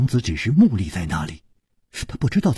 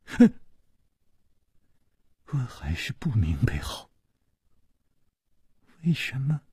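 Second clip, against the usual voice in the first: sighing, unvoiced and much softer; long sigh; 6 dB quieter than usual. A narrator's reading. 熊娘子长叹着对宫南燕说，a sighing whisper. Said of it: "长叹道".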